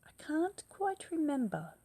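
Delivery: high, loud hesitant voice; vague Gruffalo. Acted. Gruffalo stalling his child, high and loud. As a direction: stall as if he forgets. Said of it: "the Gruffalo said".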